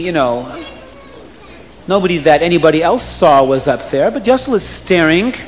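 ordinary delivery

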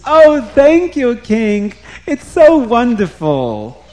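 flattering servant thanking his king; fawning Haman. Acted. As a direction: grovel sweetly to the king.

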